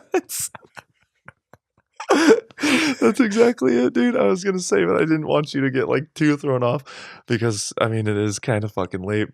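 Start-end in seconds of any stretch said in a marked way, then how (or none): none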